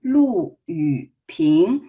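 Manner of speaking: LU falling, long YU dipping, PING rising; very slow, exaggerated tones on Lu Yuping; model tone reading. Shown as a demonstration. Teacher repeating the name for learners, very slow tones. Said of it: "So one more time".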